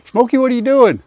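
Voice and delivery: high, loud call to the pig across the pen. Real, not acted. Farmer calling to a wandering pig, high and loud. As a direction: call out to Smokey, half alarmed.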